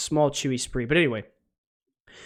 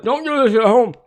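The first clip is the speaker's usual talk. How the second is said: mock announcer warning; 8 semitones higher, 8 dB louder than usual.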